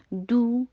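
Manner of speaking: short, rounded ڈو in recitation voice; rounded lips. Shown as a demonstration. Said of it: "ہونٹوں کو گول بھی کیا".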